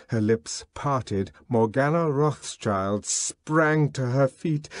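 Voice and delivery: ordinary delivery, nothing marked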